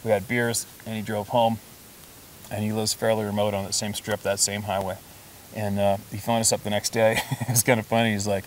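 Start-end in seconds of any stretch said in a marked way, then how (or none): none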